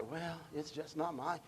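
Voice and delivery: dismissive quoted excuse, dropping to almost nothing; mock indifference. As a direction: mimic a lukewarm believer brushing it off. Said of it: "You're not gonna say".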